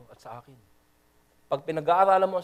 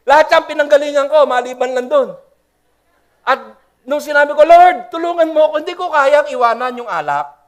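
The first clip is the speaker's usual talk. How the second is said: loud, much higher testimony; shouting his testimony, voice raised high; preaching shout; 9 semitones higher, 11 dB louder than usual. Real, not acted. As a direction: cry it out to the crowd. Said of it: "kaya ako sumisigaw".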